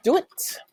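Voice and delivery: "do it" rolled into one quick syllable; rolled together. Shown as a demonstration. Teacher showing do it run together. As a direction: roll do it together fast. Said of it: "roll those two syllables together".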